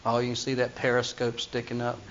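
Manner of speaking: ordinary talk, nothing marked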